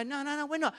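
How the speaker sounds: indignant denial. Retold or imitated. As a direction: push back, offended.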